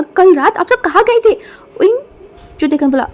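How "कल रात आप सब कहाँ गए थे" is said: loud, high accusing shout; furious demand where they went; angry shout. Acted. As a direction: yell the question at them. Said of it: "और चिल्ला के बोला".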